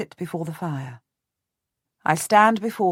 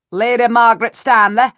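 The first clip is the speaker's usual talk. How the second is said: much louder, higher bellowed announcement; steward's bellow; 5 semitones higher, 11 dB louder than usual. A narrator's reading. Steward announcing a guest to the queen's ladies, bellowing. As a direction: bellow the name across the chamber. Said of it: "The steward of her chamber bellows".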